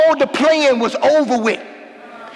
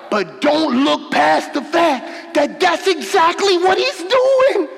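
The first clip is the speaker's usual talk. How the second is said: preaching at full force; 6 semitones higher than usual. Acted. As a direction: drive the point home with fire. Preaching peak.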